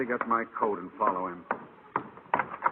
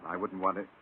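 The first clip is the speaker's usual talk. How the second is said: ordinary delivery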